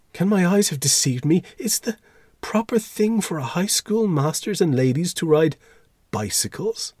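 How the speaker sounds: very high, scandalised questioning; fussy, high-pitched outrage; shocked disbelief. A narrator's reading. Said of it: "seemed petrified".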